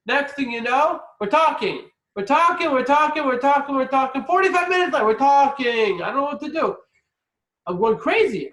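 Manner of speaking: high, loud, frantic rant; starving guest losing patience, loud and high; exasperated rant. Retold or imitated. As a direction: build a hungry man's frustration. Starving gentile ranting.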